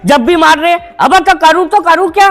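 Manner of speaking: loud, fed-up protest; loud frustrated outburst; exasperated shout. Acted. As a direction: shout back as the cornered son. Frustrated son shouting back.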